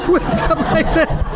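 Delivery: talking through high laughter; real laugh breaking into the words. Real, not acted. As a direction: crack up at your own story. Laughs at own shouted punchline.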